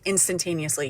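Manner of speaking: ordinary delivery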